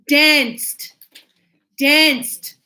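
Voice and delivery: "Danced" loud, closing on a crisp T; modelled -ed ending. Shown as a demonstration. Teacher modelling the verb for class, loud clear ending. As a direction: push out "Danced" with its final T clear.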